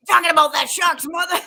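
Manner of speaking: loud, high Sam Jackson bark; Sam Jackson impression. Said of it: "something that Sam Jackson would say".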